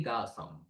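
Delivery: "கா" softly as gaa; voiced ga. Shown as a demonstration. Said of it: "okay, கா sound". ("கா" மெதுவான g ஒலி)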